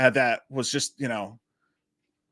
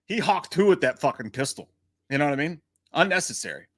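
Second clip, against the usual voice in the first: normal talking voice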